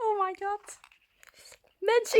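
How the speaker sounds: usual speaking voice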